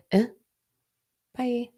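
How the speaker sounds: high dismissive "Eh, bye"; bitchy brush-off. Pretend. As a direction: wave them off with a sassy bye. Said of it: "that sounds so cunty, right? Or bitchy".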